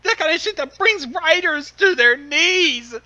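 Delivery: high, loud crying wail; hammed-up anguished cry; melodramatic wail. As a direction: wail it like a tortured writer. Dramatic reading, crying shout.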